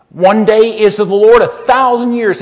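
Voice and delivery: loud, ringing scripture quote; booming quote. Acted. Preacher booming the verse to the congregation, loud. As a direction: boom the verse out to the hall.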